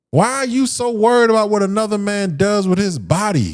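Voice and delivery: normal talking voice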